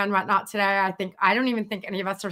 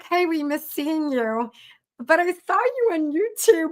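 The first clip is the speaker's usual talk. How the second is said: slow, higher voiced client, lightly done; imitating a client; 7 semitones higher, slower than usual. Financial planning client telling her he saw her on YouTube, slow and higher. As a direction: play the sweet client, drawn out and higher.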